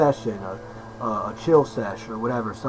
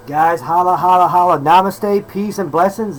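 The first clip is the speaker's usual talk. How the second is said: loud, high, sing-out greeting; hyped greeting; 5 semitones higher, 7 dB louder than usual. Real, not acted. Host hails viewers on returning, hyped shout-out. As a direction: call it out big and high.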